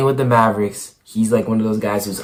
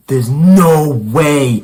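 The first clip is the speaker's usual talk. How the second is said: retold disbelief; 4 semitones higher, 7 dB louder, much slower than usual. Retelling shock to friend.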